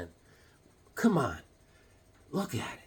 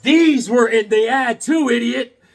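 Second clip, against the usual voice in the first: loud, high, drawn-out insult; retold scolding; 5 semitones higher, 7 dB louder than usual. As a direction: imitate an exasperated seller, slow and loud. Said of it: "He goes".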